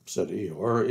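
plain reading voice

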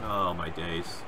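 low, soft groan of dismay; muted dismay. Real, not acted. Gamer watching a move go wrong, low quiet dismay. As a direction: say it low and softly to yourself. Dismay at game, first take.

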